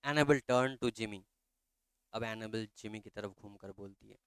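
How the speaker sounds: usual speaking voice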